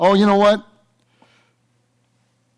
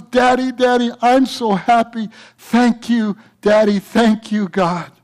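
put-on higher, excited child voice; happy little boy; 6 semitones higher than usual. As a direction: thank daddy like a thrilled kid. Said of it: "the little boy says".

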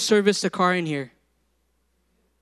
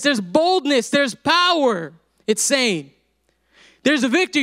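higher, emphatic preacher's delivery; impassioned preaching; 6 semitones higher than usual. Real, not acted. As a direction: drive each phrase home from the pulpit.

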